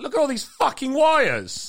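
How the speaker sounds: high, loud, slow amazed outburst; mock awe. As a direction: gawp loudly at the wires. Loud remark in VR ship.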